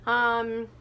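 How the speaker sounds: ordinary delivery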